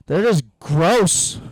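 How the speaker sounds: drawn-out disgust. Real, not acted. Ranting about disgust.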